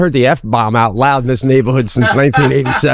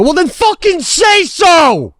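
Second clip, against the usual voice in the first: high, drawn-out furious shout; slow, pitched-up retold yell; angry shout; slower than usual. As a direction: explode at a half-hearted apology. Retold angry retort to neighbour.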